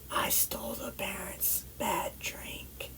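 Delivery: slow, secretive whisper; barely audible guilty whisper; whispered confession. Retold or imitated. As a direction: confess it under the breath, drawn out. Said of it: "and I whisper".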